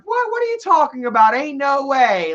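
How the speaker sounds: loud, high-pitched incredulous retort; disbelieving. Retold or imitated. Imitating Erica countering his sad story, incredulous. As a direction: imitate someone flatly refusing to buy it. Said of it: "fucking Erica's like".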